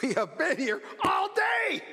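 high, loud complaining shout; impatient shout. Retold or imitated. The people of Israel complaining to Elijah, high impatient shout. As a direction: yell it like a fed-up crowd. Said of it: "I can hear the children of Israel saying".

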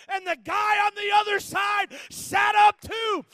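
loud, slow climactic preaching; full-force preaching. Acted. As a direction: drive it home to the congregation.

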